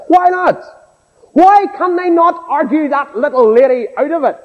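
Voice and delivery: high-pitched pulpit challenge; challenging preaching. Acted. Preacher challenges the congregation about the believer, forceful preaching. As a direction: throw the question out like a preacher.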